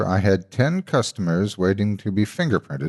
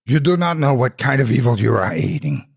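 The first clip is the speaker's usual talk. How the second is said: a zealot's raised, higher warning voice; booming red woman; 6 semitones higher, 4 dB louder than usual. A narrator's reading. Red woman warning the fleeing narrator, booming. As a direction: boom the warning down at him, higher and louder. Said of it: "the red woman boomed".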